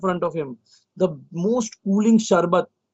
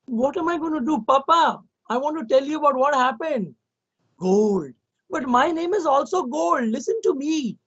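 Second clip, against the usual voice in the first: a lightly voiced pleading little girl; higher, childlike appeal to papa; little daughter; 7 semitones higher than usual. Daughter begging her busy father to listen, lightly higher. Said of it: "and she says".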